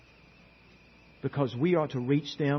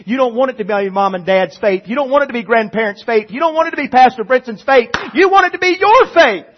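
rising higher and louder each line; building to a peak; 10 semitones higher, 13 dB louder than usual. Acted. Building climax of sermon.

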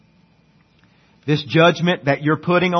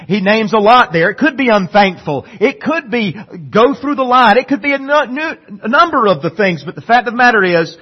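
rising sermon intensity; 7 semitones higher, 6 dB louder than usual. Real, not acted. Preaching rises.

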